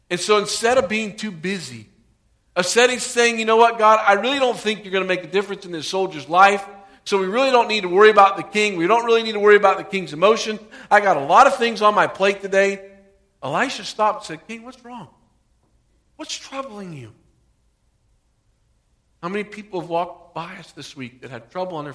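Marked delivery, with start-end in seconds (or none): 3.4-12.9 loud, brushing-off voice of someone with no time; loud impatient brush-off; dismissive too-busy voice. Retold or imitated. Preacher voices a too-busy believer. 14.5-17.1 soft, higher, caring question; a soft caring question to the king; gentle concern. Retold or imitated. Elisha gently asks the king.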